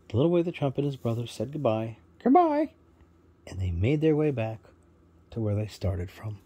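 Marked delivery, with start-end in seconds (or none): 2.2-2.7 high, loud squawky parrot; proud parrot introducing himself, high and loud. Acted. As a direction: chirp it out as a cheerful parrot bird. Parrot says goodbye.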